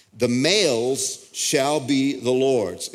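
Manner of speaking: slow, weighty stress through "The males shall be the Lord's"; emphatic. Retold or imitated. Preacher reading the law on firstborn animals, slow and emphatic. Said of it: "Emphatic again".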